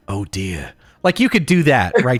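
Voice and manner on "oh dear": low, soft British sigh of dismay; a low, understated British chef impression; Gordon Ramsay. Showing a friend an easy British impression, low and dismayed. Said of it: "like, a Gordon Ramsay".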